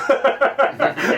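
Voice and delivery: a high burst of laughter. Real, not acted. At the co-host after recalling New Yorkers' questions, a high laugh. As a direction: laugh out at your own story.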